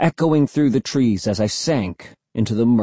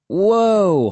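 startled whoa; 9 semitones higher, 3 dB louder than usual. Acted. Mom startled by son rushing.

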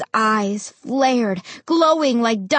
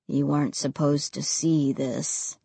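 low, heavy ghost voice; quiet regret, low and cold; deep and cold; 9 semitones lower, 6 dB quieter than usual. A narrator's reading. Ray's voice.

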